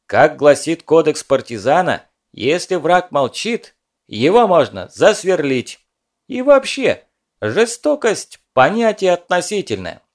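lofty, pompous recitation; mock-solemn pathos. A narrator's reading. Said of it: "добавив пафоса в голос".

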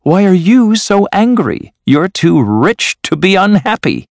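high, good-humoured challenge; smiling. A narrator's reading. Nephew replying to his grumpy uncle, smiling and high.

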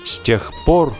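ordinary delivery

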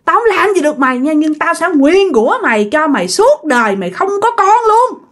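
high-pitched vengeful vow; acted furious malediction; fierce curse. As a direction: curse her for life as an angry spirit. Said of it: "Làm rất là hung dữ".

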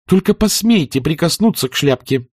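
high, sharp exclamation; guard the hat fiercely; protective cry. A narrator's reading. Scarlett shielding her hat from Rhett, sharp cry. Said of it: "воскликнула Скарлетт".

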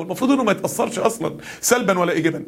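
normal talking voice

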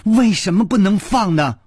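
high, indignant demand; petulant, high-pitched challenge; stamping protest. A narrator's reading. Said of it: "跺着脚说".

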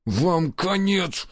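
slow, strained threat through gritted teeth. Acted. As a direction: squeeze the curse out slowly. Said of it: "сквозь зубы процедил он".

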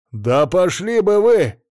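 loud, high, slow outburst; drawn-out indignant shout; angry dismissal. A narrator's reading. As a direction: tell them off, stretching the words. Drunk man tells policeman off.

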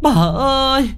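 keen over the lost son, voice breaking; mother sobbing and wailing before her son's portrait. Acted.

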